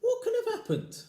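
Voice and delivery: alarmed wife. Acted. Wife's voice in story.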